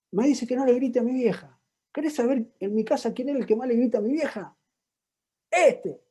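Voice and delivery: high-pitched furious rant; angry teen. Retold or imitated. Teen accusing his father of hypocrisy to the therapist, angry and high. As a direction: list the father's hypocrisies, fuming. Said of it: "Estaba enojado, enojado".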